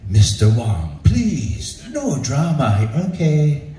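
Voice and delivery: gentle ASMR-like director voice; imitating a soothing, unruffled mediator; very calm. Director calms the worked-up storyteller, very calm. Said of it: "the A S M R of Puerto Ricans".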